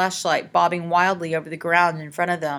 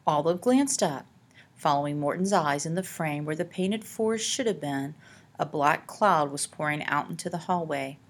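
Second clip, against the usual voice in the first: plain reading voice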